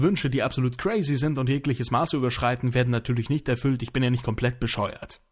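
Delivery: fast legal disclaimer. Pretend. Rapid contest small print.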